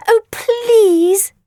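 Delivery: high, loud begging squeak; high pleading creature. Acted. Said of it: "begged Wriggle".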